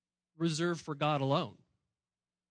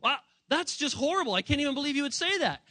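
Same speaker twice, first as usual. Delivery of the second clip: much higher, hammed-up indignant shock; mock shocked student; 7 semitones higher, 3 dB louder than usual. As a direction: play the offended teen gasping at the pastor.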